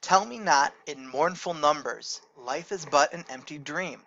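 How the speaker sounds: steady, even recitation of the verse; poem reading. Shown as a demonstration. Teacher reads Longfellow to the class, even recitation. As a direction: read it out like a poem.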